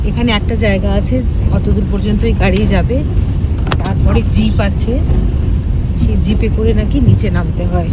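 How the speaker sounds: loud, jolted talk in a car; on a bumpy road. Real, not acted. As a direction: speak over a rough ride. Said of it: "বাপরে, কি রাস্তাটা খারাপ".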